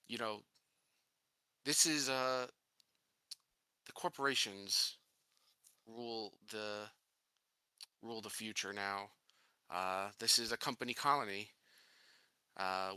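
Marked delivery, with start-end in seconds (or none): none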